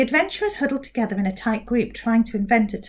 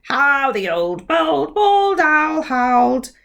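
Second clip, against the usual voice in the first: smug mouth recital; 4 semitones higher, 6 dB louder, slower than usual. Acted. Mouth repeats twister.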